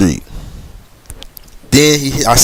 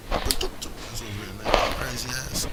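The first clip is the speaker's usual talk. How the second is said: whispered aside; 15 dB quieter than usual. Real, not acted. Muttering aside, unvoiced.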